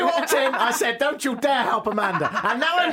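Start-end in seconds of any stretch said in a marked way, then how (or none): 1.0-2.3 schoolmaster voice. Retold or imitated. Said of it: "My, my schoolmaster voice".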